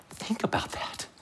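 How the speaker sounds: hushed whisper for emphasis; real, voiceless whisper to the audience. Real, not acted. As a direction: whisper it so it sinks in. Preacher lets point sink in.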